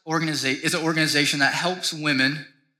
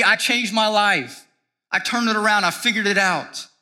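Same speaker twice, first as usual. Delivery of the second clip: loud, high mock bragging; boastful self-made voice; 7 semitones higher, 5 dB louder than usual. Preacher mocking the boastful believer, loud and proud. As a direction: puff yourself up, crowing over your own success. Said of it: "we boast in ourselves and we go".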